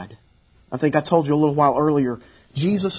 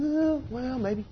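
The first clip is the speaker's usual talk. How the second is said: high, softer mock kid voice; imitated hesitant kids; 10 semitones higher, 7 dB quieter, slower than usual. Kids coming up to dad at the pool, hesitant high voices. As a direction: imitate children wavering, much higher. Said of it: "they're going".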